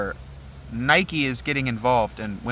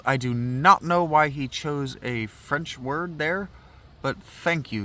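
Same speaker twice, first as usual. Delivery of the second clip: usual speaking voice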